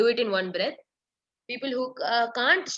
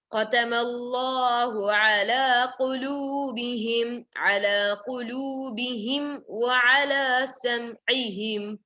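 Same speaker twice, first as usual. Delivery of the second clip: Quran recitation; 2 semitones higher than usual. Acted. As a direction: recite it measured and melodic. Teacher recites the ayah.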